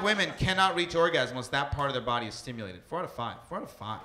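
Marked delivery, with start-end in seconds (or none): 2.8-4.0 quiet, dropped-down repeat for emphasis; softer, letting the number sink in. Shown as a demonstration. Comic repeating the stat softly to the crowd. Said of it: "repeats fact softly".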